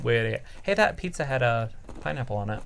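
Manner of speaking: ordinary delivery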